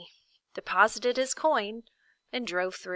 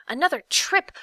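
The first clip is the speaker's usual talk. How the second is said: higher, louder shout of joy; more excited; 8 semitones higher, 7 dB louder than usual. A narrator's reading. Milo thrilled at another trip, calling louder. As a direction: burst out the second time.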